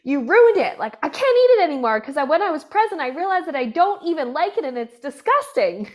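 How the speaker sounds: high, loud retold complaint; mock outraged client. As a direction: act out an exasperated client, playing up the outrage. Retold client blames therapist for ruining food.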